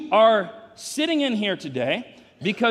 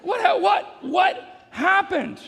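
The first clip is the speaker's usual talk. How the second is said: high shout, stretched out slowly; mock bewilderment; 10 semitones higher, much slower than usual. Father pretending shock to congregation at son's growth. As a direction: play stunned disbelief.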